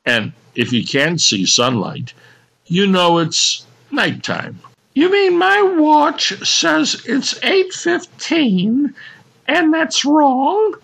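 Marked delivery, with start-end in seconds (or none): 4.9-10.8 slow, high baffled lady; high woman voice. Acted. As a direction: puzzle over it like a lady. Woman voice puzzled.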